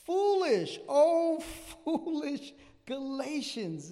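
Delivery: loud, high, drawn-out cry of reproach; concerned pastor's rebuke. Acted. Pastor Paul rebuking the Galatians, loud exclamation. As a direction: call it out slowly and loudly to a wayward flock. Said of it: "as a good pastor who's concerned about".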